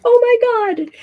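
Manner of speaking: hammed-up gasp of panic; mock alarm. Host mimicking bystanders reacting to a covid-era sneeze, mock alarm. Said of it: "everyone around you would be like".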